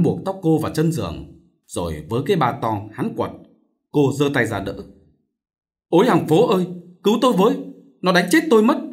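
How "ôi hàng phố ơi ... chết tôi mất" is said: high, loud plea to the neighbours; cry for help. A narrator's reading. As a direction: call out desperately for rescue. Vợ bị quật kêu cứu. (kêu cứu thất thanh)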